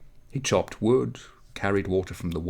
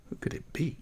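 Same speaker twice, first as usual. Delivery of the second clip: hushed wonder; 7 semitones higher, 8 dB quieter than usual. A narrator's reading. Mysterious question in the snow.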